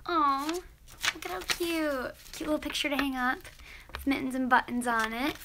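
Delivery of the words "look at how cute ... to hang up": usual speaking voice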